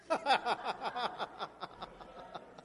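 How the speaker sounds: a burst of laughter, high; real laugh. Real, not acted. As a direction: congregation laughing at the slip.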